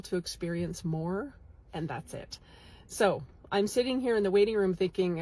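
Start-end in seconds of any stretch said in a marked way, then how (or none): none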